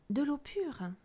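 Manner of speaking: ordinary delivery, nothing marked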